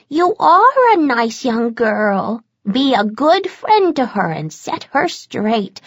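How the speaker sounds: put-on high, slow, glazed old lady; a hypnotized granny, slow and high; dazed old grandmother. Entranced grandmother chatting to Bones, high and slow.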